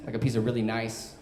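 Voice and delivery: usual speaking voice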